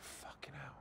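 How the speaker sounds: very soft, voiceless whisper; acted faint whisper; whispered swearing. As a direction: swear under the breath, winded. Barely able to speak after injury.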